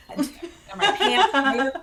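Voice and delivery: high, loud, very slow laughing wail; panicked laughing wail. Retold or imitated. Veteran retelling closet meltdown, laughing panicked wail. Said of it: "so overwhelmed".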